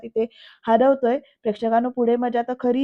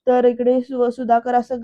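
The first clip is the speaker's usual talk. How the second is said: usual speaking voice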